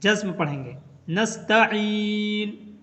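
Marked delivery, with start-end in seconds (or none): 1.1-2.8 नस्तईन stretched very slowly, ending on n; नस्तईन पर ठहराव; stopping form. Shown as a demonstration. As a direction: chant नस्तईन and stop on the n. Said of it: "बल्कि नस्तईन".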